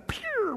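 high, quick "pew" whoosh; zip sound effect. Retold or imitated. Preacher mimicking gazelles bolting from the lion, high whoosh. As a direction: make the sound of a gazelle darting off.

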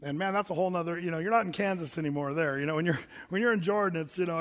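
ordinary talk

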